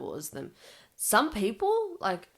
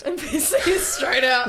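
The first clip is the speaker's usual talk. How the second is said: laughing; 7 semitones higher, 11 dB louder, slower than usual. Real, not acted. Laughing agreement with friend.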